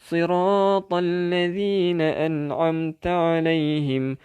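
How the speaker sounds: melodic Arabic chanting of Al-Fatihah; تلاوة مجودة; Quran recitation. Acted.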